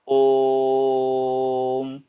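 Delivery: ओम held very long on one steady tone; prolonged pluta vowel. Shown as a demonstration. Teacher demonstrating pluta to children, a long steady ओम. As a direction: chant ओम stretched well past two beats. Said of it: "जोर बहुत लंबा".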